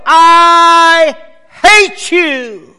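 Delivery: drawn-out high shout at full volume; angry yell. Acted. Little boy mad at his mother yells from mountain edge, angry shout. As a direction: holler it across a valley, stretching each word. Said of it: "he yelled".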